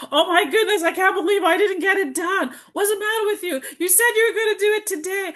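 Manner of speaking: high, exasperated mock scolding of herself; self-scolding inner critic. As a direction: nag yourself like a disappointed critic. Berating herself for not finishing.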